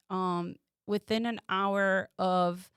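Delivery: normal talking voice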